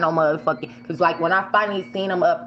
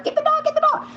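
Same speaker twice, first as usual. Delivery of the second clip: retold yell; faster than usual. Driver honking and yelling at the dog owner, high frantic yell. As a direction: yell over the horn to grab the dog. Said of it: "So I'm hitting the horn".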